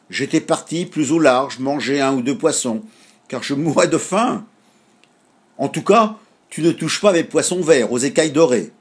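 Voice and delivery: half-joking hungry seagull; gull, greedy. A narrator's reading. Gull voiced in a story. (mouette gourmande, un peu plus forte)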